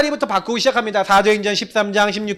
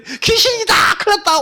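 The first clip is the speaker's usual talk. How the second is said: panicked cry; 10 semitones higher than usual. Acted. Disciples panic seeing Jesus walk.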